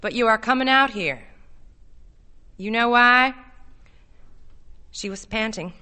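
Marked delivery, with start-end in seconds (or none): 0.0-3.3 raised, insistent voice; louder, pressing persuasion; talking over her. A narrator's reading. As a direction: push on firmly through the objection. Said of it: "talking right through her voice".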